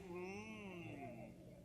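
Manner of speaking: a soft hungry mmm; quiet greedy hum. Shown as a demonstration. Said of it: "Tenemos hambre y hacen". (murmullo de hambre suave)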